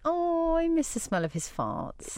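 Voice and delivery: slow, hammed-up longing; mock wistful. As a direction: act pining for an absent partner. Acted missing an absent partner.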